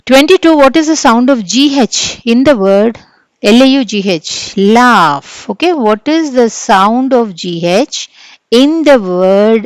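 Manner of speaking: loud, slow reading of the question; quiz reading. Acted. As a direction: announce the question to the class. (తరగతికి ప్రశ్న బిగ్గరగా చదవడం)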